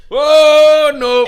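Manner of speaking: high, loud shocked whoa; shocked outburst at a friend's story. Real, not acted. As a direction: recoil in disbelief, loud and high.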